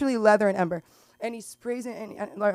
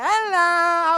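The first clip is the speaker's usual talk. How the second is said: a hint of a loud, higher Italian accent; 9 semitones higher, 8 dB louder than usual. Comic imitating a loud Italian customer, lightly. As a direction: belt a hammy Italian hello. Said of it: "loud Italian".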